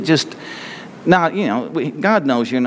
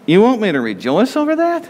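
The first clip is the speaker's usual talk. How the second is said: incredulous; 6 semitones higher than usual. Retold or imitated. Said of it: "look at things and say".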